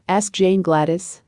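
ordinary delivery

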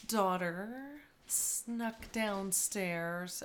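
usual speaking voice

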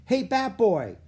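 high, loud holler; loud kid's shout for attention; kid calling out. A narrator's reading. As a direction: call down from the stands. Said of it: "called from the stands".

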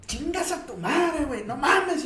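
high, frantic cursing; panicked rant. Retold or imitated. Friend coming downstairs panicking, cursing in a high voice. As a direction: blurt it out in a panic.

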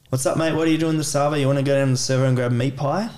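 fast, slurred Aussie mate-talk; Australian conversation. Shown as a demonstration. Demonstrating Australian chat for learners, person one, fast Aussie slang. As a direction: rattle it off in broad casual Australian. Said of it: "perhaps an Australian conversation".

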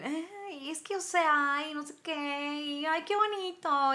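hammed-up prissy schoolgirl gushing; mocking girly voice. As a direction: parody the squeaky fresa girls she can't stand. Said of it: "no aguanto el ... súper femeninas".

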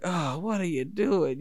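a hint of a frustrated coach, slow; drawn-out, mildly exasperated coach voice; lightly voiced coach, let down by his player. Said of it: "the coach looking so frustrated".

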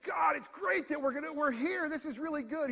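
high, loud nervous rambling; frightened Peter babbling too loud; flustered babbling. Retold or imitated. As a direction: blurt it out in a panic to fill the silence. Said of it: "He's terrified".

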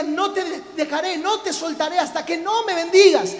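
loud, high, insistent plea; crying out. Acted. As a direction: cry it out to God, refusing to let go. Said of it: "él gritaba y decía".

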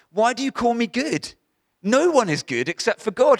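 high, loud challenge leaning on "me good"; "Why do you call me good?" as a high, loud protest; misread emphasis. Shown as a demonstration. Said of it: "it read like this".

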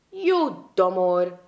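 slow, loud scolding shout; drawn-out angry yell of a teacher. A narrator's reading. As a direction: bellow it at the boy like an angry schoolmaster. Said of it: "schreeuwde hij".

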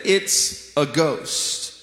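drawn-out, narrated fright; understated terror. A narrator's reading. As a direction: read the frightened cry very slowly. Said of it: "they were terrified and said".

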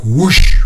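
loud rushing whoosh; imitated whoosh. Storyteller imitating energy rushing back into his body, loud whoosh. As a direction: make the sound of energy surging in.

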